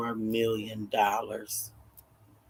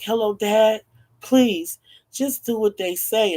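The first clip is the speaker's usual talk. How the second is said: higher-pitched daughter on the phone; pleading daughter; 7 semitones higher, 4 dB louder than usual. Retold or imitated. Kidnapped daughter begs her dad on the phone, pleading. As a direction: plead up high, starting louder. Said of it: "She was like".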